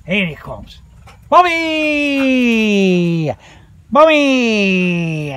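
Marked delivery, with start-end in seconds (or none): 1.3-5.3 long, high falling call; calling the dog. Real, not acted. Man calls Bobby back to him, long-held shout.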